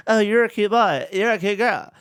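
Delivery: mocking Disney romance. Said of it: "it's almost like".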